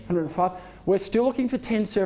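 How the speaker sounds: ordinary delivery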